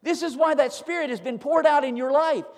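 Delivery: scripture read out, climbing into loud, high exhortation; full-force preaching. Real, not acted. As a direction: build to a fervent, ringing pulpit climax.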